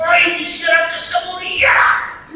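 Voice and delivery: put-on high, bitter child's wish. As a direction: voice the hurt child wishing the father dead. Child's angry wish quoted.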